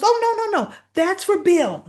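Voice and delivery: high, loud, urgent objection; mock alarm. [name] stopping Haley taking Bill's cupcake, acted alarm. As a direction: jump in to stop someone, voice leaping up.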